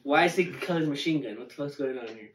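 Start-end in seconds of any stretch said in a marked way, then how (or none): none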